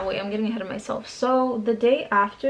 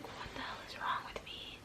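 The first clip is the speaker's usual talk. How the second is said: breathy whisper of disbelief; whispered to herself; 18 dB quieter than usual. Retold or imitated. Girl at the dentist whispering to herself in disbelief. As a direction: hush it out, half to herself. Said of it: "I was like".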